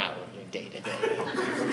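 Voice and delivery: mock the question back, slow and sullen; sulky teen reply. Preacher voicing a teen sullenly echoing the parent's question.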